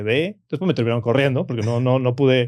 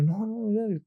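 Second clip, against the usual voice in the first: llanto imitado; 9 semitones higher, 6 dB quieter, much slower than usual. Retold or imitated. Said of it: "un mar de lágrimas".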